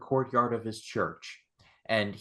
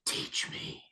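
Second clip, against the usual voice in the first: whispered teach me; awed whisper to a master; hushed awed plea to the sorcerer; 11 dB quieter than usual. Retold or imitated. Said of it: "I was like".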